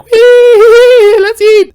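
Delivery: very high squealing cheer; excited squeal. Retold or imitated. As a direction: squeal with glee like a kid. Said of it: "She's like".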